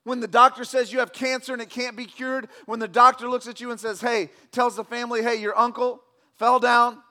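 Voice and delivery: loud, high, driving pulpit voice; rousing sermon delivery; full-force preaching. Acted. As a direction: preach it out to the whole room.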